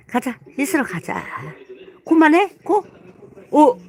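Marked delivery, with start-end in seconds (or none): 2.1-3.8 sharp high scolding shout; loud startled telling-off; stop it. Real, not acted. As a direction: snap at the dog to stop. Owner scolding dog mid-bath.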